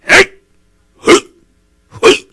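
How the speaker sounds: loud, high indignant huffs; angry huffing. Retold or imitated. A stubborn man huffing in anger as he leaves. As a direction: scoff and huff away.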